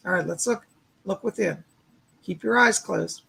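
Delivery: calm, steady visualization guide; walk them gently into the light; guided meditation. Acted. Guiding the viewers to picture a spark of light, soothing meditation voice.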